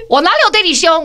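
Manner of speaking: mock indignant. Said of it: "然后他就说".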